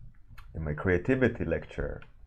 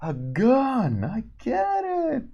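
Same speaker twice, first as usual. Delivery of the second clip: much higher, slow dawning voice; sudden realization; much slower than usual. Retold or imitated. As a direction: squeak out a goofy aha moment. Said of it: "you go".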